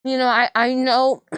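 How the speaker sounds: talking with a mouthful of food; mouth full. Real, not acted. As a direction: speak around a bite of food. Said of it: "I'm talking with my mouth full".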